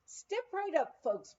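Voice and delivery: high, welcoming barker call; encouraging. Retold or imitated. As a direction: invite the crowd up to the bar. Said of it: "Peter encouraged them".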